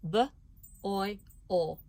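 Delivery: each sound said apart, with pauses; B, oi, o spoken one sound at a time. Shown as a demonstration. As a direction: segment the word into sounds. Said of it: "listen as I say these three sounds".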